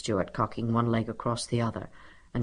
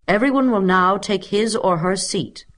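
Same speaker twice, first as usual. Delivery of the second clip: louder, higher teacher's order; commanding; 6 semitones higher, 5 dB louder than usual. A narrator's reading. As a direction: command the class to sit. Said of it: "commanded Stuart".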